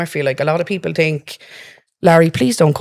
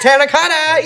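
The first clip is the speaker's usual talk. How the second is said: Terracotta pitched way up and dragged out, Limerick style; 9 semitones higher than usual. Shown as a demonstration. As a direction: stretch out Terracotta like a Limerick local. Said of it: "everything is dragged out in Limerick".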